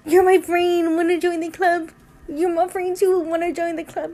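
bubbly, like greeting a new friend; gushing welcome. Retold or imitated. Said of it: "and I'm like".

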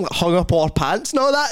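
ordinary talk

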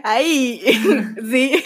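laughing nervously through the words. Real, not acted. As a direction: giggle nervously while agreeing. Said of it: "me río de nervios".

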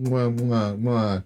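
soppy repeated kiss sounds "mwah"; blowing an air kiss. Retold or imitated. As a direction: smack out three air kisses. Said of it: "it's not like".

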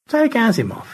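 gracious high thanks; George. Acted. George thanks both rescuers, genteel high voice.

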